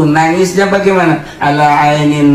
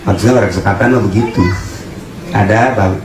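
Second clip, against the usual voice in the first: normal talking voice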